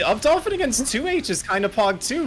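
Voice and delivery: hyped, high and loud; excited pitch up. Real, not acted. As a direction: sell the hot take with hype. Hyped take on move.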